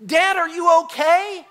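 high, loud, drawn-out question; concerned son. Retold or imitated. Son looks back at fallen father, concerned call. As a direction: slowly call out as a worried son. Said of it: "And my son, who's, like, jogging normally".

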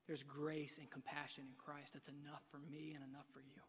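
ordinary talk, nothing marked

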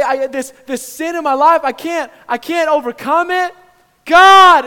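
high, frantic shouted pleading; terrified son. Acted. Terrified son pleads with God about the dragon, shouting. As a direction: cry out to God in loud panic. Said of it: "The child is terrified".

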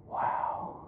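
awed whisper. Retold or imitated. Awed by the pool room.

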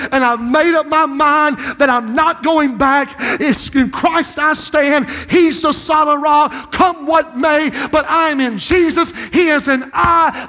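slow, shouted declarations; full-force preaching. Acted. Pastor to the congregation, slow shouted testimony. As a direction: preach it out with conviction.

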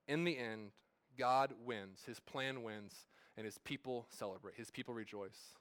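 slow, falling preacher's emphasis; solemn sermon cadence. Acted. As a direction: let each phrase land and drop. Closing sermon point, slowing, softening.

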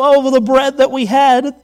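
loud, whiny mock lament; mock complaining Israelites. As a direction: ham up their moaning. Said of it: "they go".

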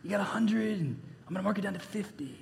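hushed mobster impression; mafia voice. Preacher imagines steward collecting debts like mafia, hushed mobster voice. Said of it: "he's probably doing that".

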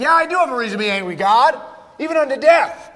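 mock indignant back-talk; mouthy Jonah. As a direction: argue back, rising in pitch and volume. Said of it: "gets a little mouthy with the Lord".

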